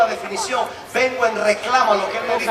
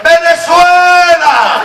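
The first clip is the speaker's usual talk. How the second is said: long, drawn-out, much louder and higher cry; bratty shout; 9 semitones higher, 12 dB louder, much slower than usual. Retold or imitated. Preacher answers God defiantly, a slow bratty shout. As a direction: shout it back like a cheeky child. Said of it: "de malcriado dije".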